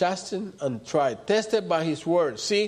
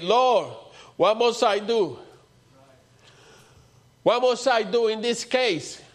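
high, raised plea to the Lord; crying out; 5 semitones higher than usual. Retold or imitated. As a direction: act out a desperate appeal to God. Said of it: "crying out".

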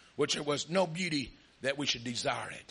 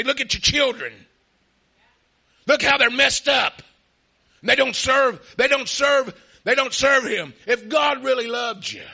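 loud accusing devil; 7 semitones higher, 11 dB louder than usual. Retold or imitated. Devil shouting accusations about the children, voice raised. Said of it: "he'll say".

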